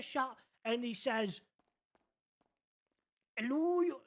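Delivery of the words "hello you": a hint of an old man, pitched up; brief mock old man greeting; attempted old man. Narrator greeting the driver as an old man. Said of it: "I can't do an old man accent".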